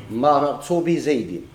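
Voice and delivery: مررت بزيد read slowly, leaning on بزيد; slow example phrase. Shown as a demonstration. Teacher dictates an example sentence, slow and deliberate. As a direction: draw out مررت بزيد for the class. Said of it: "المرور شكون وصلوا زيد؟".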